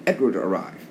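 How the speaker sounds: ordinary delivery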